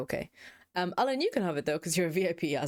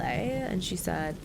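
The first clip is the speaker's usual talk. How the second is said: ordinary talk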